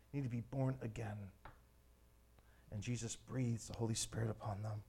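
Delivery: low, very soft sermon voice; hushed reverence. Acted. Jesus breathes the Spirit on the disciples, hushed. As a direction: speak softly and solemnly, with long pauses.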